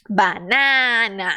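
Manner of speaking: slow "Banana" with open a's. Shown as a demonstration. Teacher models the open a. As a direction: draw out "Banana" with an open mouth. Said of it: "abra a boca".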